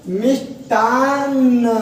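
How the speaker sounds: मिष्टान्न very slow, the half न held long; slow model pronunciation. Shown as a demonstration. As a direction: stretch मिष्टान्न out so every sound is heard. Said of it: "आधा न के साथ आपका क्या होता है". (मिष्टान्न बहुत धीरे, आधा न खींचकर)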